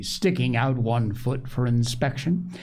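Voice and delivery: ordinary delivery, nothing marked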